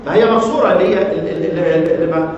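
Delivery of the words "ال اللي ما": ordinary delivery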